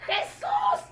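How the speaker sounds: very high shout over a crowd; short man shouting to be heard. Acted. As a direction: yell up at the crowd's edge. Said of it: "Porque Saqueo gritaba".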